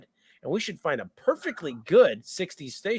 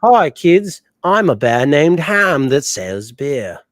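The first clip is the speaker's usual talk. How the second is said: put-on cheery, loud kids' TV host voice, slow; PBS Kids show voice; 11 dB louder, much slower than usual. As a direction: greet the kids like a children's TV host. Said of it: "read that in the, uh, P B S Kids Show voice".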